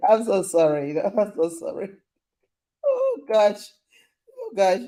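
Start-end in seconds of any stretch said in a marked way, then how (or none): 2.8-4.8 amused exclamation. Real, not acted. Laughing exasperation.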